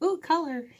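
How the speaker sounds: high, awed little gasp of discovery; childlike wonder. Retold or imitated. Newborn marvelling at earth's first colours, high and awed. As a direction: breathe it like a newborn seeing colour.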